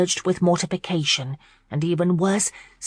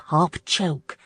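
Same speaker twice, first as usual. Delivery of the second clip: ordinary delivery, nothing marked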